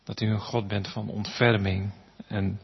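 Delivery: hushed, reverent prayer voice. Acted. Closing prayer to God.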